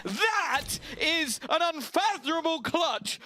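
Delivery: screaming hype call, building high; esports caster. Real, not acted. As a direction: yell the clutch call at full hype. Caster calls the clutch.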